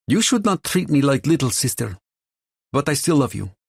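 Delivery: put-on a scolding then fond line in a thick Russian accent. A narrator's reading. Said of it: "in her thick Russian accent".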